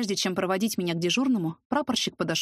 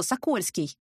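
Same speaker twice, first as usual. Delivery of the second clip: usual speaking voice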